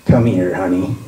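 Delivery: low, mock come-on; put-on low seductive voice; amorous woman. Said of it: "tried to smooch me".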